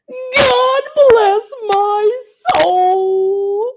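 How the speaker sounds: slow, high, choked-up Santa exclamation; a tear in his throat as he says it; moved old Santa. Acted. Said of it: "he said at last".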